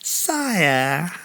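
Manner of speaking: high oily courtier; slimy tone. A narrator's reading. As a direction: ooze flattery like a groveller. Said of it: "they spoke in tones of great sliminess".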